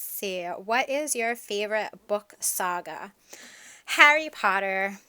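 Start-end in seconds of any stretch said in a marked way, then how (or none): 3.8-5.0 blurted out loud and proud; excited loud exclamation of a fan; eager answer. Real, not acted. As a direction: announce your favourite like it's obvious. Answers favourite book saga question.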